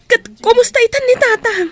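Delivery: sudden high, loud worried cry; anxious burst about her father; panicked plea for news; alarmed question. Acted. Sister brings news of father.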